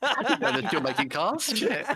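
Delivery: laughing mock awe; slow, laughing mock wonder. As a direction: giggle it out slowly.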